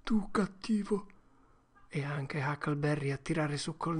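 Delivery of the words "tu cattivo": hushed, sniffly whisper; tearful whisper. Acted. Huck whispers sniffling to Tom.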